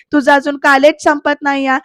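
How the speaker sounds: ordinary delivery, nothing marked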